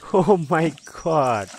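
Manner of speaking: ordinary talk, nothing marked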